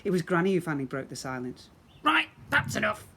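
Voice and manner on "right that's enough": high, loud bark. Acted. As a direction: snap at the whole crowd like a grumpy granny. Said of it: "she barked".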